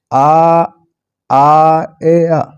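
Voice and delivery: आ, ए, आ drawn out as separate sounds; sounds separated. Shown as a demonstration. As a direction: slowly spell out आ... ए... आ sound by sound. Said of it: "तीन single sounds को एक sound".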